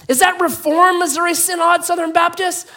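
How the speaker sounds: high, loud acted question; probing rescuer. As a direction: grill him from above, hammed-up. Rescuer calls final question.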